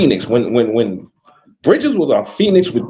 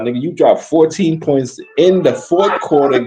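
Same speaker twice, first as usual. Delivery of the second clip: ordinary talk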